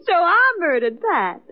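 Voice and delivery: ordinary talk, nothing marked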